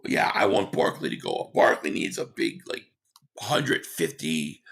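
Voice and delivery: normal talking voice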